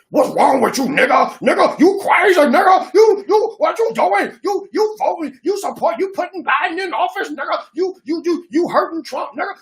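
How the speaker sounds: hammed-up high scolding voice; mock angry voter. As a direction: squeal it like an outraged caller. Mocking Democrat voters in his inbox.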